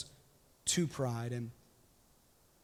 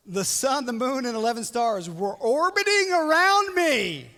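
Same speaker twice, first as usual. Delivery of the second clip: slow, loud, higher gloating; grand boast; 8 semitones higher, 8 dB louder, slower than usual. Retold or imitated. Joseph brags of stars orbiting him, slow and grand. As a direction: lay it on grandly. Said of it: "Joseph bragging dream".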